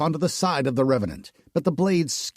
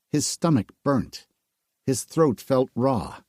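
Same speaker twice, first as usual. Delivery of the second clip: ordinary delivery, nothing marked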